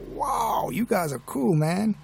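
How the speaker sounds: put-on awed kid's American drawl, high-pitched; American accent. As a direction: gush in a lightly mimicked American accent. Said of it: "he kind of picked up this American accent".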